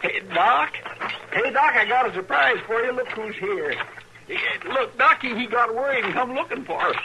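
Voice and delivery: bursting to share the good news; excited calling. Acted. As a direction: Chester calling to Doc, excited and loud. Excitedly announcing rescuer's arrival.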